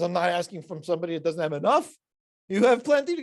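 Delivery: loud, rising insistence; insistent complaint. Retold or imitated. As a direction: push the demand hard. Elazar insisting on more.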